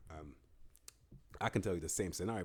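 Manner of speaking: usual speaking voice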